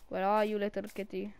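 normal talking voice

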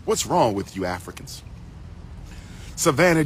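ordinary talk, nothing marked

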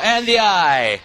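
ordinary talk

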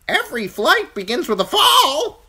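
very high, loud bird voice; insistent crow. A narrator's reading. Crow teaching falling Bran, insistent squawk. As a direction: proclaim it in a squawk.

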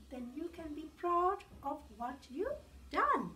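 ordinary delivery

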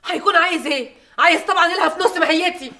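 loud, high-pitched indignation; indignant retort. Acted. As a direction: fire back loudly, pitched well above normal. Mother rebuking her son in quarrel.

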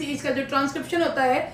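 ordinary delivery

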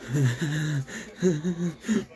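high, breathy mad giggle; creepy giggle. Acted. Psycho giggling madly at Takomi. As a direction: giggle like a deranged child.

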